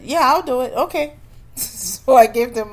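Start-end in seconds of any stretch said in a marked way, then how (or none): 0.0-1.1 higher and louder, eager agreement; jumping at the offer; eager yes. Retold or imitated. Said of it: "but I said".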